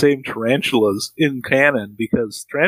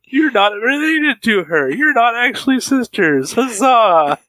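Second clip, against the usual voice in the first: higher, jubilant mock announcement; higher mock celebration ending in huzzah; mock cheering dad; 9 semitones higher than usual. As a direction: cheer it out like a proud father. Joining in as the dad.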